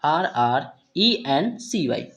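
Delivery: very slow, letter by letter; spelling out letters. Shown as a demonstration. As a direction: spell each letter out slowly with pauses. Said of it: "next word सी यू". (धीरे-धीरे अक्षर बोलते हुए)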